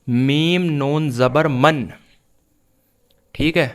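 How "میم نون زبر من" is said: slow letter-by-letter reading ending on من with a sounded n; teacher slowly spelling out من; spelling demonstration. Shown as a demonstration. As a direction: spell out میم نون زبر slowly, then blend into من with a clear n. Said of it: "تو یہ کیسا پڑھا جائے گا".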